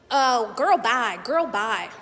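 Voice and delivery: imitating a man's neck-rolling sass; mocking sassy voice. Man at restaurant taunting her, mocking sass. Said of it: "And he was like".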